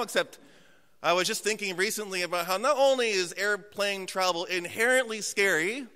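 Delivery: ordinary delivery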